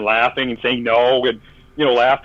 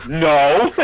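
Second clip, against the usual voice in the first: laughing refusal. Retold or imitated. Security guys turning down Keith's request, laughing.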